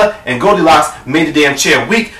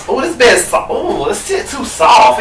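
acted complaint; 6 semitones higher than usual. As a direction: stretch out the high, fussy complaint. Goldilocks trying Mama Bear's bed.

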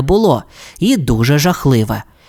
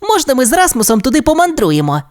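put-on high, quick childlike request; pleading little dinosaur; 10 semitones higher, faster than usual. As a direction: ask permission in a rush, high-voiced. Timmy asks permission to travel.

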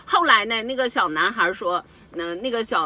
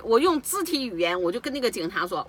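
usual speaking voice

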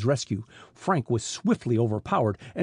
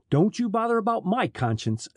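mild sneer, pitched higher; 8 semitones higher than usual. A narrator's reading. Smuggler chief to his captive detective, lightly sneering. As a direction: brush him off with a light sneer. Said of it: "sneered Snackley".

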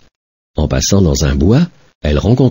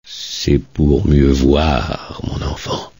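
very slow, low, sinking into a whisper; sly wolf fading to a whisper; 4 semitones lower, 4 dB quieter, much slower than usual. Acted. Wolf luring the girl, slow and low down to a whisper. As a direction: draw her close with a hush.

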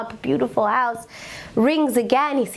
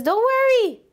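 very high sing-out reassurance; breezy reassurance; 13 semitones higher than usual. Retold or imitated. Dog owner to the scared visitor, high breezy reassurance. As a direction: imitate a host waving away fear, pitched high.

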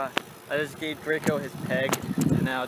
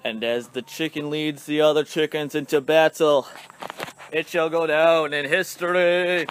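louder, grand storyteller voice; mock epic narration; 3 semitones higher, 7 dB louder than usual. Narrating chickens' march as a mock war epic.

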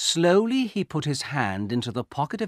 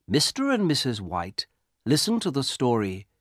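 ordinary delivery, nothing marked

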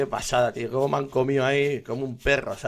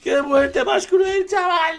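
laughing shout; 13 semitones higher, much slower than usual. Real, not acted. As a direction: howl it in disbelief. Repeats the line as a shout. (gritando, agudo y lento)

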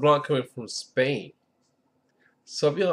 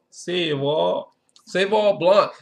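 mock French accent; 3 semitones higher than usual. Shown as a demonstration. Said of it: "I don't have my French accent going on".

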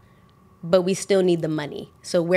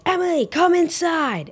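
higher, slower put-on manager's order; bossy manager voice; 10 semitones higher, slower than usual. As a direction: imitate the boss calling her back in. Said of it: "My manager, Brittany, is like".

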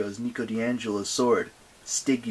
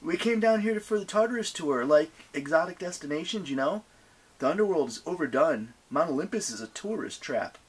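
higher-pitched, lightly voiced tourist chat; bluffing young hero; 6 semitones higher than usual. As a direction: play along as a blasé tourist.

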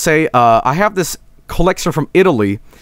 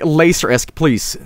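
usual speaking voice

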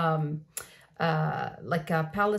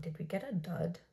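hushed whispered worry; whispered aside to viewers; 2 semitones lower, 11 dB quieter than usual. Real, not acted. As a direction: breathe the question quietly. Whispers doubt about the product.

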